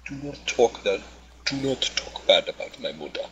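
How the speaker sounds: imitated heavy African accent. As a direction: warn them sternly in a mock African accent. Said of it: "a really good African accent".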